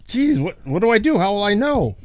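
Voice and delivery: high fretful questions; worried husband. Acted. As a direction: panicky fool asking for help. Joke husband's worried reply.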